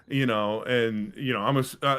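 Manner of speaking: ordinary delivery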